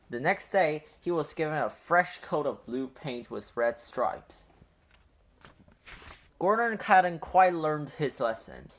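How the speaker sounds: ordinary delivery, nothing marked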